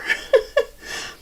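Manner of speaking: breathy high laugh; short real laugh. Real, not acted. Laughs at the costume.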